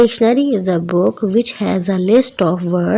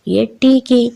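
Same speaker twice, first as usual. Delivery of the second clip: ordinary talk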